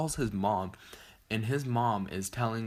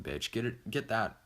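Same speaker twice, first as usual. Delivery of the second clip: ordinary delivery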